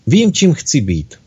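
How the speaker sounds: understated storybook excitement; great enthusiasm. A narrator's reading. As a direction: announce it eagerly, lifting each "Vím". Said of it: "s velkým nadšením".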